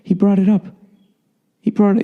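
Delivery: high, innocent little voice, pitched well up; mock-innocent pleading in a high voice. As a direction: play it wide-eyed and blameless, like a kid caught out. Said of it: "he said the most innocent voice ever".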